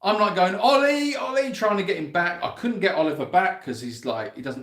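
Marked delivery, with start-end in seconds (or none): 0.6-1.5 high, loud call across the field. Real, not acted. Owner calling her runaway lamb back, loud and high.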